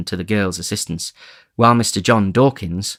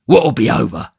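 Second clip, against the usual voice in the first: loud savage demand; 3 semitones higher, 6 dB louder than usual. Acted. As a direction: snap it at her. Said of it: "in a savage voice".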